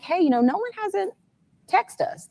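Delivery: high, light retold worry from her sister; imitating her sister. Mother voicing her sister noticing Nolan hasn't texted, high. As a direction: pitch it way up as the sister's voice.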